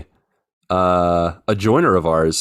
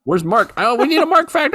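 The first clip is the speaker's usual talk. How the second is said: much higher, squeaky mock panic; mock alarm. To cohost, demanding a Mark fact, squeaky mock panic.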